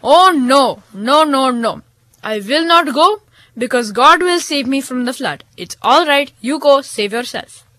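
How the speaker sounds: lightly voiced old man refusing help; stubborn holy man. A narrator's reading. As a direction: Manda Baba turns the villager away. Manda Baba refuses calmly.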